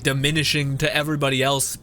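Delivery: ordinary talk, nothing marked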